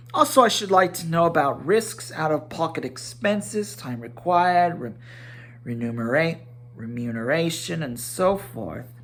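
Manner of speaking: a stubborn hobbit talking terms; obstinate business manner. Acted. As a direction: dig in politely but firmly. Bilbo lists business terms.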